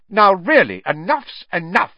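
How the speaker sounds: loud, sharp scolding teacher; stern. Acted. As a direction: cut the noise off sternly.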